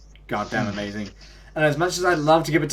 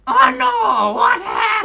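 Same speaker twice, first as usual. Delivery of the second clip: very high loud squeaky cry; high squeaky impression; 10 dB louder, slower than usual. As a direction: shriek it like the character. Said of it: "all I hear in my head is just".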